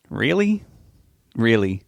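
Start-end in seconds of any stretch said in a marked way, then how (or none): 0.1-0.6 can't believe the news; stunned disbelief. A narrator's reading.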